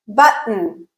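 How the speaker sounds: "Button" with a clear glottal stop for the T; "Button" with T replaced by a catch before a syllabic N. Shown as a demonstration. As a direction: say "Button" in two parts, throat closed between. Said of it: "So let's listen to it".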